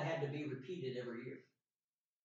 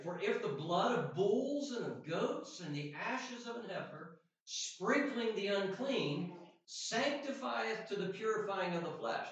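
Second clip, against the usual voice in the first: scripture reading; 4 semitones higher than usual. Acted. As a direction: read the verse out deliberately, stretching key words. Reads scripture aloud.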